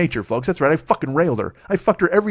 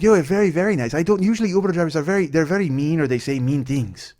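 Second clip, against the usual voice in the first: imitated Mexican accent, pitched up at first; heavy Mexican accent; 4 semitones higher than usual. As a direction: do the passenger's thick Mexican accent. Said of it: "she had a really heavy accent".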